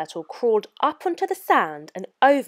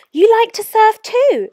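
bright, high and loud with a smile; smiling; 13 semitones higher, 9 dB louder than usual. A narrator's reading. Moana greets the sea turtle with a smile. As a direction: beam at the turtle as you say it. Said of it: "smiling at the sea turtle".

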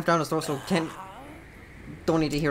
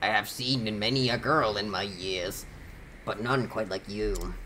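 low raspy smoker voice; 4 semitones lower than usual. Acted. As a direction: read it like a lifelong smoker. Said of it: "I should have just done the smoker voice".

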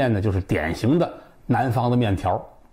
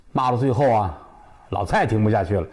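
plain reading voice

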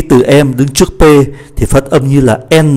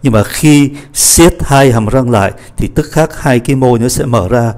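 ordinary talk, nothing marked

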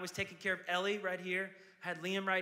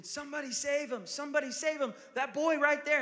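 retold scream; 8 semitones higher, 4 dB louder than usual. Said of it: "I start screaming".

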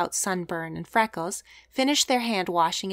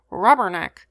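louder, a screamed parrot cry; screaming parrot; 7 dB louder than usual. A narrator's reading. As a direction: scream it out like an angry bird. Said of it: "screamed Romeo again".

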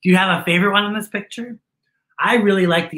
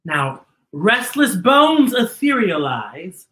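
slow, rising sing-song spooky read; slow spooky storybook rhyme; spooky verse reading; 7 semitones higher, 4 dB louder, slower than usual. Acted. Reads rhyming book verse.

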